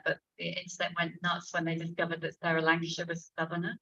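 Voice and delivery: ordinary talk, nothing marked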